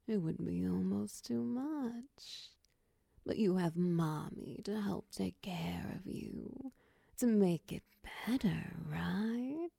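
usual speaking voice

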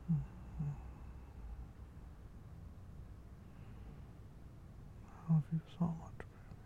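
soft whispered sweet nothings. Real, not acted. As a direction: whisper it tenderly, drifting off. Tender words while falling asleep.

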